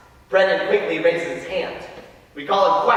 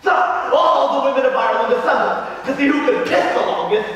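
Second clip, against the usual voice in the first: higher-pitched, eager tall-tale telling; excited boy storyteller; 5 semitones higher than usual. Acted. Mikey tells Frankie the rude legend, excited and eager. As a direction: relish the naughty legend, building with glee.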